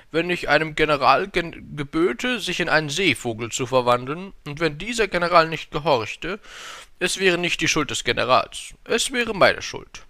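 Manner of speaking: high, loud, stately king voice; dozierender König. A narrator's reading. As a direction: lecture like a self-important ruler. King explains his authority. (hohe, laute, hoheitsvolle Königsstimme)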